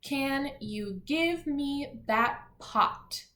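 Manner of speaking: deliberate, spaced-out read-along; read together. Shown as a demonstration. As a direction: lead a class reading in unison, slowly. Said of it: "Let's read them all together".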